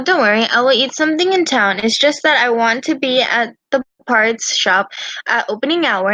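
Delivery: ordinary delivery, nothing marked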